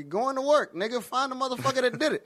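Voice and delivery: high, brash comeback; cocky retort. Pretend. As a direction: sass the cop. Retort to cop, hypothetical.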